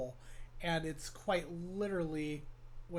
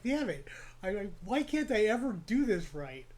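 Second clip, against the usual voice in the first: higher-pitched, amused frustration; mock exasperation; 4 semitones higher, 4 dB louder than usual. Venting about never getting breakcore right, mock exasperation. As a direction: replay your own frustration half-laughing. Acts out his own past frustration.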